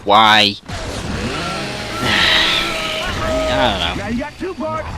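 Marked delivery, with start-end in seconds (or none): 0.6-3.5 drawn-out exasperated sigh; long weary sigh. Real, not acted. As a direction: let out a long, baffled breath. Baffled why she keeps returning.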